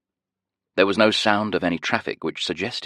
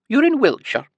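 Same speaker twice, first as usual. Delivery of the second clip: high, loud sing-song Scottish lilt; chirpy high nurse; 6 dB louder than usual. A narrator's reading. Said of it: "a heavy Scottish accent and a high-pitched sing-song voice".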